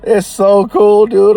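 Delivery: high, loud, slow gush of delight; bursting with giddy joy; overjoyed, stretching out cool. Real, not acted. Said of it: "I get so giddy".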